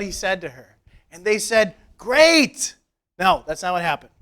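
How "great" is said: mock cheer. Mock disciples' cheerful reply.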